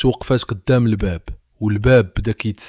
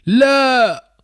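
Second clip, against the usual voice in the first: high, loud desperate "no!"; father shouting in panic; father's scream; 6 dB louder than usual. A narrator's reading. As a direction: yell to stop her stepping forward. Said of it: "الأب صرخ".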